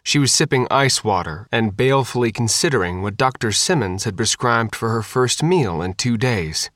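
plain reading voice

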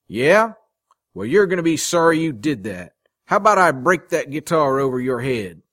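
loud, high-pitched belligerence; angry threat. A narrator's reading. Man threatening to smash rival's guitar, angry.

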